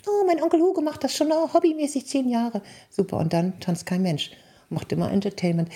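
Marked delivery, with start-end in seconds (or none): 0.1-2.6 high, mocking voice of a client; sing-song mimic of a stingy couple; mock cheapskate couple. Speaker mocks clients who let the uncle DJ, high mimicry.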